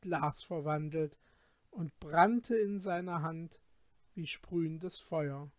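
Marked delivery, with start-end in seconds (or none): none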